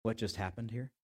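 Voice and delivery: low, hushed gossip to a neighbour; muttered aside. Acted. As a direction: lean in and mutter it quietly. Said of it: "He leans over and goes".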